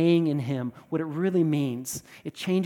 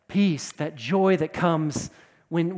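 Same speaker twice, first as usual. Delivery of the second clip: normal talking voice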